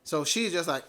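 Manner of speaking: usual speaking voice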